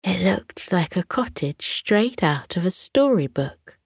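usual speaking voice

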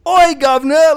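loud, high hammed-up Cockney shout; mock Cockney. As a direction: holler it in a rough put-on Cockney accent. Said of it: "All Cockney".

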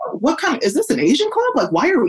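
high-pitched, questioning mock surprise; puzzled onlooker. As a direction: act out someone taken aback and puzzled.

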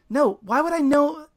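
high, loud shocked protest; shocked denial. Acted. [name] shocked at the accusation, protesting. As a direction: blurt it out in disbelief. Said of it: "I'm shocked".

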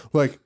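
exaggerated huffing breaths; heavy mask breathing. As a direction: imitate someone huffing into a surgical mask.

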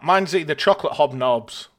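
normal talking voice

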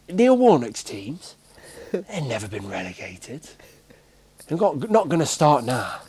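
mock-grand boast, stretching the words out; retold manager's boast. Manager talking himself up to new players, mock-grand. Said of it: "he was talking in third person".

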